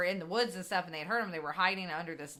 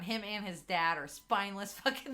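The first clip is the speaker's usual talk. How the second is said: ordinary talk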